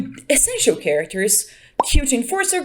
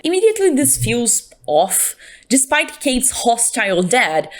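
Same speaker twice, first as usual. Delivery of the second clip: ordinary delivery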